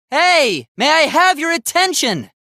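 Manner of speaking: high, loud shout with HAVE stressed; shouted call for attention. Acted. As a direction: yell over the room to be heard. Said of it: "I just wanted everyone to listen".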